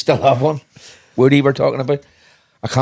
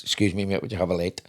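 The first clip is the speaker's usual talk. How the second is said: retold in a soft, low English accent; 6 semitones lower, 8 dB quieter, faster than usual. Famous guy asking a stranger for a light, English accent. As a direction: quote him quietly with an English accent. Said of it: "English accent".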